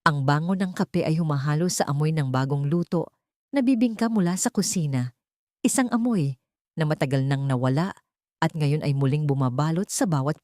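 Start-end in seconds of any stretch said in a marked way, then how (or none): none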